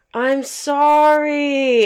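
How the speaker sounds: high, very drawn-out mock apology; long, whiny-drunk "sorry", imitated; imitated intoxicated friend. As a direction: stretch it out like someone wasted. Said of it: "that's how I knew she was fucked up".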